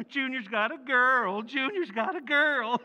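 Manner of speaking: teasing laugh. Acted. Father teasing son about his girlfriend, laughing. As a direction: repeat it to tease, amused.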